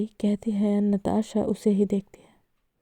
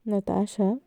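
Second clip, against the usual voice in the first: ordinary delivery, nothing marked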